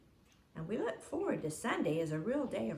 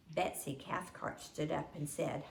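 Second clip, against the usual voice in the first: ordinary delivery, nothing marked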